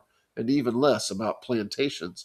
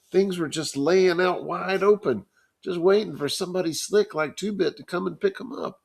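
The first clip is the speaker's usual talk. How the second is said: Johnny, lightly voiced; 7 semitones higher than usual. Johnny voiced higher, lively.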